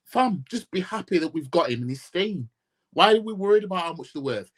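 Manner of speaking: usual speaking voice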